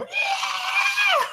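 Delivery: long, high-pitched war scream. Acted. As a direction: scream it out like a charging slasher.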